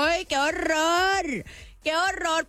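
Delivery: high, drawn-out dismay; mock horror. Streamer reacting to family defending roomie, drawn-out mock horror. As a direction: wail it in hammed-up exasperation.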